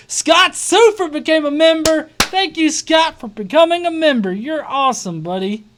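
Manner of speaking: high, loud, delighted announcement; real burst of enthusiastic thanks; excited shout-out. Real, not acted. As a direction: hype up a new member. Shout-out to a new member.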